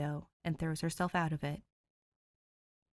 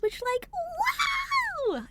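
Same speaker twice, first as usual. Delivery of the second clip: much higher, slow squeal; excited; 4 dB louder, much slower than usual. Real, not acted. The reader, thrilled about passing a hundred subscribers, squealing. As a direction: squeal with real delight. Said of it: "I'm so excited".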